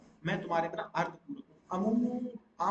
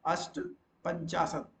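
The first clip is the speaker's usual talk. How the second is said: "अष्टपंचाशत" slowly, broken after "asht"; slow, careful dictation of "अष्टपंचाशत"; slow model word; much slower than usual. Shown as a demonstration. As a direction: split "अष्टपंचाशत" into "asht... panchaashat". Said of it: "को बोलते हैं अष्टपंचाशत".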